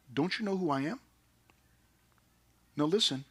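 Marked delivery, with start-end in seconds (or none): 0.1-1.0 hushed disbelief. Retold or imitated. Acting Saul's disbelieving humble answer.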